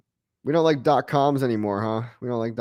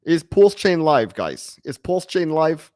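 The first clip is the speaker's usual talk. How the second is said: put-on Dutch accent; 5 semitones higher, 3 dB louder, slower than usual. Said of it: "I kinda like the Dutch accent".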